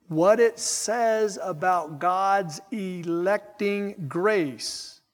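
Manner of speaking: slow, loud, deliberate pulpit delivery; emphatic preaching. Acted. Preacher declaring doctrine to his congregation, slow emphatic preaching. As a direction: hammer each phrase out slowly, with weighty pauses.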